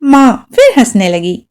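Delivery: usual speaking voice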